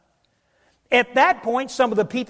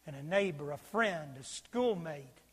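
very soft, low preaching; solemn hush; 4 semitones lower, 11 dB quieter than usual. Real, not acted. Preacher pictures judgment on lost humanity, solemn hush.

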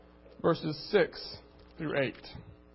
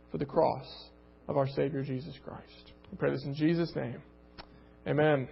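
prayer; 4 semitones lower than usual. Real, not acted. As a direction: pray it low and hushed. Closing prayer.

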